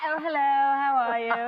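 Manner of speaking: mock polite greeting; suddenly well-behaved. Women greeting a daughter's boyfriend, exaggeratedly well-behaved. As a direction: put on company manners for the guest. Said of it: "They get well behaved all of a sudden".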